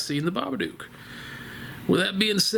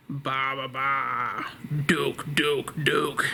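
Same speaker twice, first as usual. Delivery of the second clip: creepy Babadook call; 4 semitones lower, much slower than usual. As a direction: croak it low from the ceiling. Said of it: "the Babadook on the ceiling".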